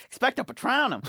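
high mock spell cast; a hint of a wizard spell. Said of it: "that reminded me of the".

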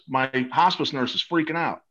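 ordinary talk, nothing marked

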